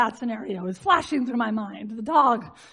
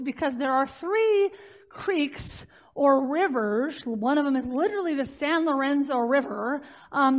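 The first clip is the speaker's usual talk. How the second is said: ordinary delivery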